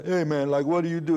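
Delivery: hammed-up New York dude; New York hipster. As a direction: call it out like a club regular. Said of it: "all these different sounds in New York".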